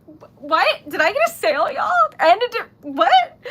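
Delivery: high, loud astonishment; stunned disbelief. Real, not acted. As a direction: blurt it out in excited shock. Stunned by an unexpected sale.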